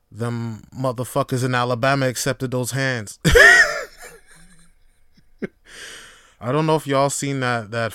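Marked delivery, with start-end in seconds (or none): 3.2-4.1 sudden high loud laugh; burst of laughter. Real, not acted. Laughing at the riverboat brawl video, a sudden loud laugh. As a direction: crack up at the thought of the brawl.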